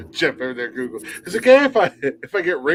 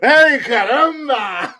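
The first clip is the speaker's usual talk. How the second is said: mock Spanish exclamation; 10 semitones higher, 3 dB louder, much slower than usual.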